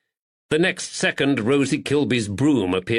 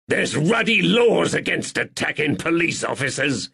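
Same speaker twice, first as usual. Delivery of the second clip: voice cracking with pain; 6 semitones higher than usual. Acted. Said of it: "It cracked with pain".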